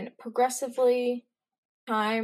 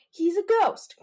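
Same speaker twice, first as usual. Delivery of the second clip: mock excited; 10 semitones higher, 6 dB louder than usual. Friends joking about a ghost.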